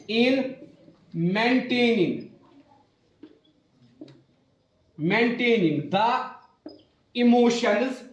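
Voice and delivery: slow, word-by-word dictation pace. Acted. Teacher dictating notes to her class, slow and deliberate. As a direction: spell it out slowly for students to write.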